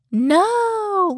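high, held mock wail; ghostly female voice. Host imitates female voice caught on the show, high wail. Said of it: "you just hear this female voice being like".

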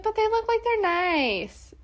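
high, slow, meek little voice; timid Kelly impression. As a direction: play her as shy and hesitant. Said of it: "She'd be like".

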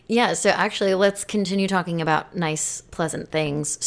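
normal talking voice

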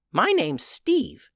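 high, slow little goblin voice; a small goblin, simple and earnest, slow. Acted. Goblin introduces himself.

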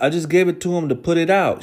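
high, innocent "What? What?"; mock innocent. Bonnie playing innocent to grandma. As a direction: play dumb, high and light. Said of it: "And she's like".

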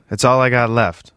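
a touch of a yell, kept within narration. A narrator's reading. Desperate man to the angel, mildly yelled. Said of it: "he yelled".

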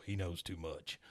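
hammed-up villain aside; Stinky sinister. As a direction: murmur it darkly. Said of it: "you hear Stinky go".

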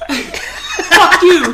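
high, loud drawn-out yell; comic high-pitched outburst as a dog; talking-for-a-dog voice. Acted. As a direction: shout it high like a dubbed dog. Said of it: "people talk for their dogs".

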